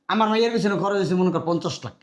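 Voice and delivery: ordinary talk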